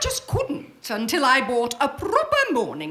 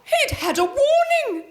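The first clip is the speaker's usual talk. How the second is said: dramatic exclamation; 11 semitones higher than usual. Acted.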